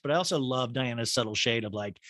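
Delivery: ordinary delivery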